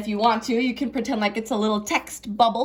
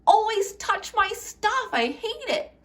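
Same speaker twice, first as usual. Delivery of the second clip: high, slow whiny complaint; high slow petulant grumble; 11 semitones higher, slower than usual. Shown as a demonstration. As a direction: whine it like a hurt kid. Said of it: "So instead of".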